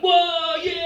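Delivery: high, loud hype shout; hyped whoop. Retold or imitated. Kids celebrating their joke, high excited whoop. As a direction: whoop like kids who just made a joke. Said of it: "They're like".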